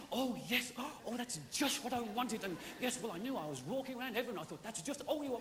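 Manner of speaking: high, breathy gasps of delight; mock gushing woman, gasping with joy. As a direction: gush over the present. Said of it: "women get presents, it's all".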